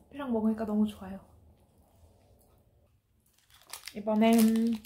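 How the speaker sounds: chewing while talking; speaking between bites; mouth full of cookie. Real, not acted. Seen 2: bites cookie at 2.2s, then chews while speaking.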